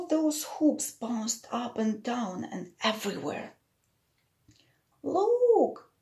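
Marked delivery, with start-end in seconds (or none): 5.0-5.9 high excited call; excited exclaim. Acted. Boy calling out at the bouncing hoops, excited. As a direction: cry it out with delight. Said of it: "exclaimed a boy".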